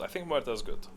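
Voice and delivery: ordinary talk, nothing marked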